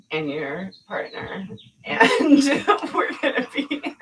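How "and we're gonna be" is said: high, loud burst of laughter; laughing. Real, not acted. Listing who comes along to the gate, laughing. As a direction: laugh right through the words. Nervous laughing about the plan.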